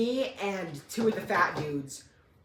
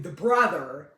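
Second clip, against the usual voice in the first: plain reading voice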